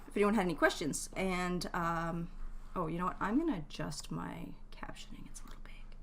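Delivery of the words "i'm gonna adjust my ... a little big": soft, voiceless near-whisper; whispery self-talk; muttering to self. Real, not acted. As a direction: trail into a hushed aside while fiddling with settings. Muttering to self while adjusting captions.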